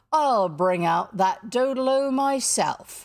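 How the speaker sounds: slow, loud captain's vow; menacing declaration. A narrator's reading. Hook seizes lantern and claw, loud menace. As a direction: declare it forcefully, drawing out words.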